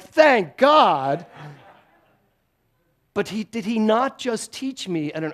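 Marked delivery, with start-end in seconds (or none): 0.0-1.2 put-on teen relief. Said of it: "you're like".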